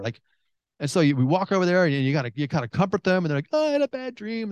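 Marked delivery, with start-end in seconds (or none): 3.5-4.5 high, plaintive mock child's cry; imitating crying child. Child telling a parent about a nightmare, high mock crying. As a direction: put on a upset little kid's voice. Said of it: "They're crying, like".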